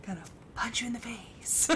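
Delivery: whispered mock threat; hushed joking menace. Replying to her dad, whispered mock threat. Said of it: "I'm like".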